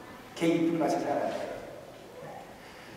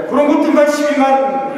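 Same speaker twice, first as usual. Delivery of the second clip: emphatic; 6 semitones higher, 5 dB louder than usual. Shown as a demonstration. Preacher repeats the verse phrase.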